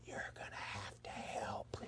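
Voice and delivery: low, slow, breathless whisper; slow hushed plea for help; strained whisper. Retold or imitated. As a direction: whisper it as if straining under a weight. Stuck holding heavy man.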